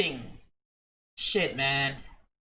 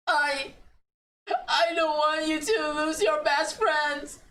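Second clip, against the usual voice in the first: pleading; 5 semitones higher than usual. Acted. Emotional plea to friend.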